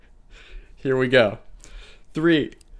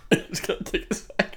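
breathless laughing words; 8 semitones higher, 6 dB quieter than usual. Real, not acted. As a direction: talk through giggles. Laughing mid-chug.

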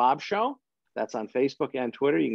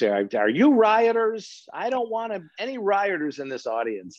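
retold yelling; 7 semitones higher than usual. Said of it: "I was yelling at people".

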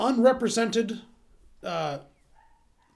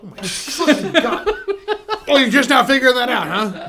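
laughing mockery; 3 semitones higher, 6 dB louder than usual. Real, not acted. Mocking friend's late realization.

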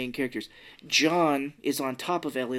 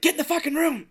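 high, raised mock yell; 4 dB louder, faster than usual. Retelling yelling at the nervous couple on TV, yelling. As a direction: yell it urgently, pitched way up. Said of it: "But I yelled".

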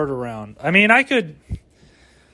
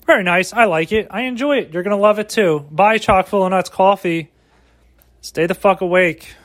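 higher, hammed-up commercial pitch; mock ad read; 4 semitones higher than usual. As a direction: sell the coffee like a cheesy ad.

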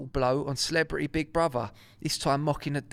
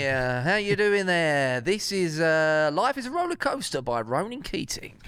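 radio DJ voice; 2 semitones higher than usual. Retold or imitated. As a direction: introduce the song like a radio DJ. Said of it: "he can go from geezer to".

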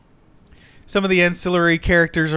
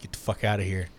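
low, much softer menacing threat; quiet warning from the hooded vigilante; 7 semitones lower, 11 dB quieter than usual. Retold or imitated. Vigilante warns the assassin to leave, low and menacing. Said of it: "and he's like".

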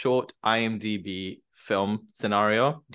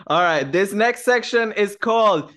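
loud, high game-show announcement; announcer voice; 8 semitones higher, 6 dB louder than usual. Acted. Host announcing the next game section, big announcer voice. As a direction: hype up the segment title.

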